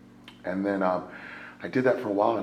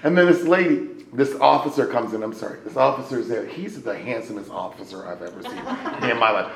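ordinary talk, nothing marked

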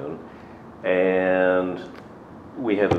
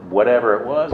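usual speaking voice

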